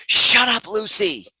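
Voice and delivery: high, loud reenacted snap at the dog; acted-out exasperated shout; triggered yell. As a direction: yell it sharply, scolding a barking dog. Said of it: "I get triggered".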